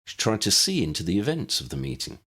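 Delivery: plain reading voice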